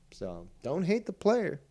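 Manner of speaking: ordinary talk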